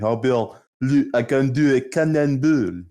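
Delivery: imitating a thick French accent; mock French exchange student, excited. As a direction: garble it with a heavy French accent. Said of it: "we had a French foreign exchange student".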